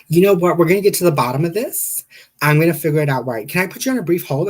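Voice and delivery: high, loud receptionist impression. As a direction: rattle it off like a call handler. Receptionist voiced in retold call.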